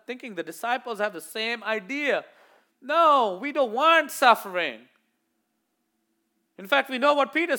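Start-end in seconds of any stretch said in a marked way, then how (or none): 2.8-4.9 louder, higher objection; protest. Retold or imitated. Disciples protesting Jesus's talk of suffering, loud. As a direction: push back hard.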